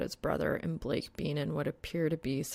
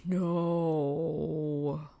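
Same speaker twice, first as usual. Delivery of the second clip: whining. Acted. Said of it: "he just ends up whining".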